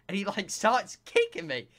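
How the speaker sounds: usual speaking voice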